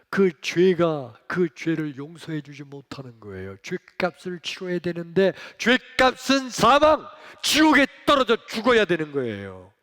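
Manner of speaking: loud, high preaching voice; fervent preaching. Acted. Pastor urging the congregation, fervent loud preaching. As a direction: preach it with full force.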